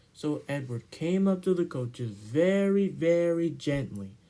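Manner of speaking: usual speaking voice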